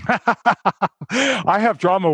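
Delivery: laughing through the words; slow, laughing aside. Real, not acted. Laughing while talking.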